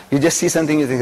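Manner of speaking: normal talking voice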